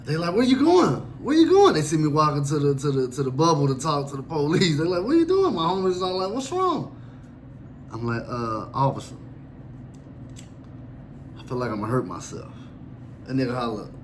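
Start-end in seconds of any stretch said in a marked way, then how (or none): 0.3-1.8 higher and louder, calling after a friend; alarmed calling. Retold or imitated. Homies calling after him as he walks off, alarmed. 5.0-7.0 alarmed friends. Retold or imitated. Homies baffled as he heads to the police. 8.3-12.5 low, soft and hesitant; meek confession. Retold or imitated. Inmate quietly telling the officer a scripted line.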